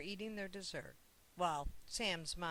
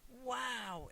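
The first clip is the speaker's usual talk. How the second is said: put-on higher, bright little "Wow!" of a boy; excited exclaim; 7 semitones higher than usual. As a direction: let it burst out, amazed. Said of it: "exclaimed Sam".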